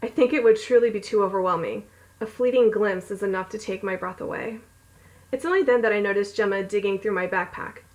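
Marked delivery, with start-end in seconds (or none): none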